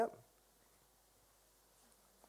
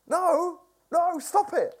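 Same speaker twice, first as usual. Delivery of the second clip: mildly raised, higher-pitched command to stop; firm interruption; 9 semitones higher, 3 dB louder, slower than usual. Acted. Preacher voicing God halting anxious striving, firm interruption. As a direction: cut in firmly, pitched up.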